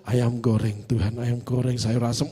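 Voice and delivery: low, soft chant of food; mock prayerful murmur. Hungry preacher secretly prays for fried chicken, mock murmur. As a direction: murmur the menu like a devout prayer.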